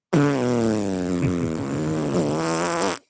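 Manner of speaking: long fart noise sliding down in pitch; drawn-out mouth-made fart sound; imitated fart. Dad mimics his son's fart on the street, long fart noise. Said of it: "Rips off this hot ice cream fart".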